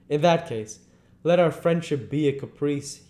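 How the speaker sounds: plain reading voice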